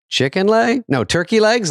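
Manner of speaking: mock questioning. Retelling the sketch ending to everyone, mock questioning. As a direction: toss out each guess as a high question.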